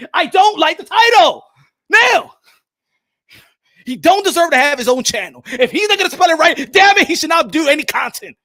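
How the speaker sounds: hammed-up angry shouting; mock rant. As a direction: rant like an offended fan. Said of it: "mock rant about the title".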